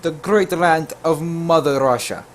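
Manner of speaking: put-on light Russian accent. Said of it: "I tell her that I'm from".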